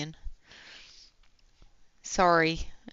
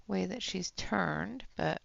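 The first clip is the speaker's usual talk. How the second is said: ordinary delivery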